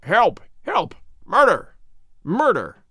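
restrained scream, loud then easing off; mock scream for help. A narrator's reading. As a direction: call for help in short bursts, short of screaming. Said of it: "a sudden scream of".

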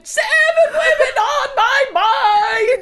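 high, slow, laughing shout; laughing mock scream. Retelling his floor-rolling scream with a laughing high shout. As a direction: belt it out while cracking up. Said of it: "rolling on the floor screaming".

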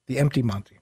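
quiet repeat. Shown as a demonstration. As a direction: mutter "The empty Monty" again. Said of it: "you don't like when I say it twice".

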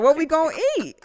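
high, loud mock husband, laughing; husband asking what's for dinner. Wife mocks husband waiting to be fed, high and loud. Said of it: "He's looking at you like".

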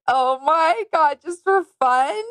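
put-on high gushing girly voice; a breathless, high-pitched gush; imitating Gabby. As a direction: gush it out like a giddy contestant. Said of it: "She's like".

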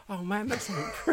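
high-pitched retold awe, with a laugh; mock starstruck. Host recalling seeing the guest at Adobe Max, starstruck. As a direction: play up a fan spotting their idol.

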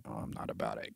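a breathy unvoiced brush-off; whispered dismissal. Whispering a brush-off of a weird voice. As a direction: whisper it like you're not interested. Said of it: "I'm like".

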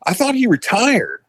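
high-pitched, baffled protest; retold disbelief. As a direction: voice jumps up in imitated astonishment. Retold disbelief at deputy's death.

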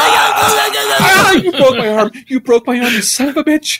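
high, hammed-up yelp of pain; mock pain. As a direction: cry out as if your arm just snapped. Acted broken arm in a struggle.

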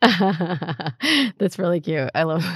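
real laughing as they talk; laughing appreciation; amused laughter. Real, not acted. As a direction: say it through giggles, delighted by the joke. Laughing at the co-speaker's joke.

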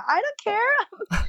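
high, slow, crying it out; tearful defiance. Real, not acted. To her podcast host about her ex, tearfully defiant. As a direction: choke out a tearful shrug. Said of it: "And I'm gonna cry my".

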